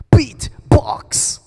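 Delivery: "Beat" popped hard and loud, "box" whispered; beatbox "Beat, box" with punched B; stress on the B. Shown as a demonstration. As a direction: burst the B like a snare. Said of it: "you stress on the B".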